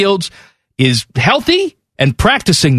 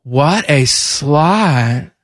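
very slow creaky drawl; Tiffany's dragged-out fried voice; mock vocal fry; much slower than usual. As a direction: stretch it out in a lazy fry. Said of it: "Tiffany said".

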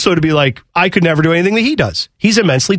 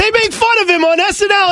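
very high, frustrated hinting; worked up, voice pitched way up; exasperated; 14 semitones higher than usual. Real, not acted. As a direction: throw out clues in a high-pitched voice. Still grasping for the actor's name.

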